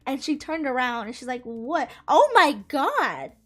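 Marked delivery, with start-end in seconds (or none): 1.4-3.3 drawn-out "Oh my God", slow and loud; a friend gasping at the sight; shocked gasp. Retold or imitated.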